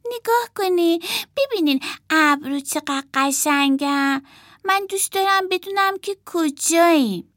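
put-on high, marvelling child voice; girl doll admiring clouds, high and amazed; wondering little girl. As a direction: gaze up in wonder.

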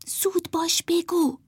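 high, hushed, impatient prompt; grumbling. A narrator's reading. Emma pressing Martha to say the line, grumbling in a hush. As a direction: nag under the breath. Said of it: "غر زد که".

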